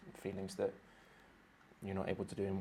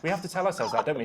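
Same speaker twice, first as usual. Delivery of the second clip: higher and louder, insisting; emphatic; 8 semitones higher, 9 dB louder, faster than usual. Real, not acted. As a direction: push the point playfully. Insisting on a point, half-joking.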